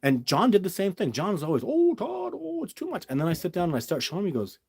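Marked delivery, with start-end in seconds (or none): 1.7-3.1 very high-pitched imitation of a doubter; mock protest. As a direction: mimic a colleague's high, overwhelmed objection.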